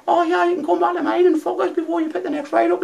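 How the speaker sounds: very high mock women's voices; imitating the lasses. Radio callers teasing the driver in very high mock voices. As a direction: squeak it like the girls on the radio. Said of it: "the lasses are going".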